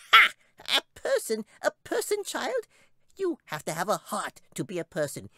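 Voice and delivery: very high, laughing squeak, slowing to a grim name; tiny creature scoffing, then dark; scornful squeaky chipmunk. A narrator's reading. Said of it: "looked at Katy and laughed".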